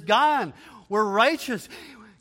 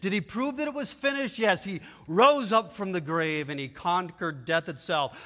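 ordinary talk, nothing marked

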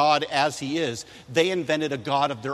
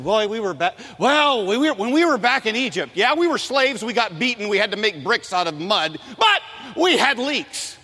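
high, loud whine that drags out the last words; whiny complaining; 6 semitones higher, 4 dB louder than usual. Retold or imitated. Israelites griping to Moses, loud whiny complaint. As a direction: grumble like spoiled ex-slaves. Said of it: "They're complaining".

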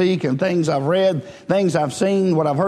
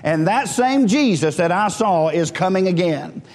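usual speaking voice

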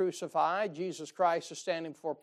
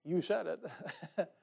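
slow, amused drawl with a laugh in it; wry, slow, laughing reply; knowing "you said it"; 5 dB quieter, much slower than usual. Retold or imitated. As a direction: lean back and let it land with a chuckle. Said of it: "this is how I hear he says it".

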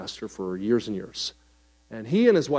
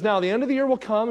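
smiling teacher; 9 semitones higher, 3 dB louder, faster than usual. Retold or imitated. Sweet teacher voiced smiling.

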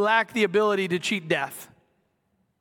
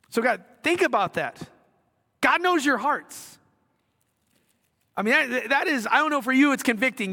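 preaching; 7 semitones higher than usual. Acted. Preacher raising voice to congregation.

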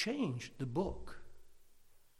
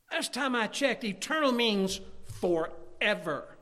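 raised, high, loud preacher voice; emphatic pulpit proclamation; forceful preaching; 7 semitones higher, 4 dB louder than usual. Acted. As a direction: preach it with ringing conviction, stretching the key phrases. Preaches assurance of eternal life.

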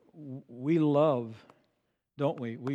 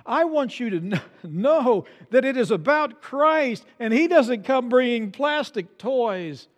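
louder, emphatic pulpit exhortation; forceful preaching; 6 semitones higher, 7 dB louder than usual. Real, not acted. Preacher pointing his congregation to Christ, forceful preaching. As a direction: drive the warning home to the congregation.

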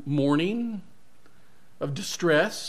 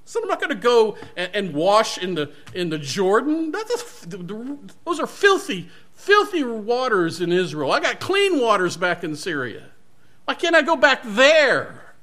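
higher, louder indignant commander ranting; angry; 6 semitones higher, 6 dB louder than usual. Acted. Proud commander raging at being snubbed by the prophet, higher and louder. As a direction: rage with wounded pride, climbing in pitch. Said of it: "he goes off in a rage".

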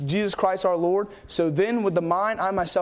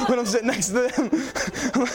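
amused, breaking into laughter; sheepish laugh at himself; 4 semitones higher, slower than usual. Real, not acted. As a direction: laugh through the words. Laughing at his own embarrassment.

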